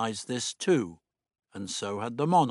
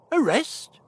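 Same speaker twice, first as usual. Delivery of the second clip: shocked; 4 dB louder than usual. Acted. Doctor shocked by arrest.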